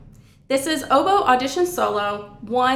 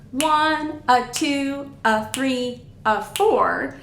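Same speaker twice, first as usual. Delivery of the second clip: moving rhythmic count, numbers held less long; faster dotted count; much slower than usual. Shown as a demonstration. Teacher showing the dotted pattern up to speed, faster rhythmic counting. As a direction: count the numbers briskly with a quick "a" before each. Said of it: "When we're moving faster".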